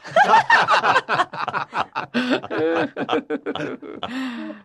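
hearty group laughter; crowd laughing. A narrator's reading. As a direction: everyone bursts out laughing. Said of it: "Everyone laughed".

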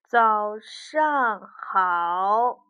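slow, halting bear greeting. Acted. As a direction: drag every word out. Bear's first words.

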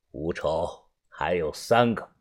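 very low, soft, measured voice; cold gang boss. Acted. Tian Sanjiu counting remaining targets for the narrator, cold and low. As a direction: count them off low and coolly.

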